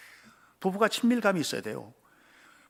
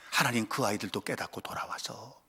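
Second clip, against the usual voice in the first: much softer, whispered plea; whispered prayer; 9 semitones lower, 10 dB quieter than usual. Retold or imitated. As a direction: pray it under your breath. Voiced prayer for children. (속삭이듯 기도)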